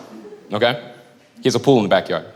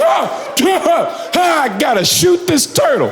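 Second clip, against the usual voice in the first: high, halting realization; mock meltdown; 7 semitones higher than usual. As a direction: sputter toward the crazy decision. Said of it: "saw his brain come to that conclusion".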